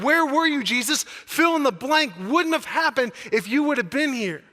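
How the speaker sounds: loud, high, repeated desperate demand; a hurting believer shouting at God; anguished outcry. Acted. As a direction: hurl each question up at heaven. Congregant crying out to Jesus.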